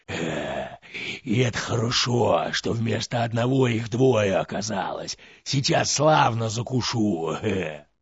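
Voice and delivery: a sly monster serpent relishing his meal. Acted. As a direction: gloat like a greedy serpent about to eat. Serpent voice in fairy tale.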